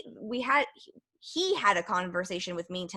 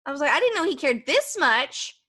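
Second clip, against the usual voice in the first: pitched up, astonished and touched; surprised disbelief; 5 semitones higher than usual. Retold or imitated. She is stunned that her crying boyfriend cares. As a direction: sound amazed that he cares.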